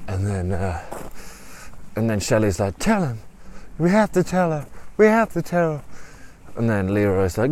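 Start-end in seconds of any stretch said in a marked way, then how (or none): 2.8-5.8 high, pressing plea; urgent pleading. Acted. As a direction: urge them to come clean. Shelley urges him to confess.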